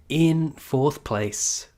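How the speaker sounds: slow, drawn-out reveal; ranking announcement. Acted. Streamer announcing the next rank to chat, slow and grand. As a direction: stretch it out like a game-show host. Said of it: "yes, drum roll".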